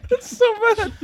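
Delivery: high-pitched laughing. Real, not acted. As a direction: squeal with laughter. After gross bean.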